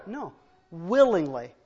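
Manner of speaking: ordinary delivery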